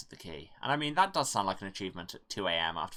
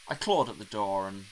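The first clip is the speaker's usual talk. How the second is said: ordinary delivery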